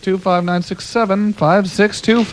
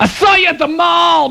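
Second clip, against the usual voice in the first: mock shout; 7 semitones higher, 3 dB louder than usual. Host shouts mock greeting.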